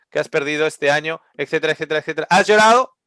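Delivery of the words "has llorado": pressing, raised question; louder question. Acted. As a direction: push the question at them. Preacher challenging the congregation. (pregunta en voz alta al público)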